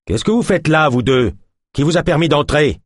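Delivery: sharp, high angry demand; whip-crack voice. A narrator's reading. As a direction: snap the words like a whip. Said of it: "avait claqué comme un coup de fouet".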